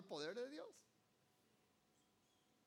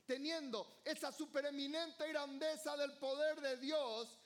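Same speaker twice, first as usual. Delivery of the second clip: full-voiced sermon climax; fiery preaching; 3 semitones higher, 4 dB louder than usual. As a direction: preach it out to the whole church.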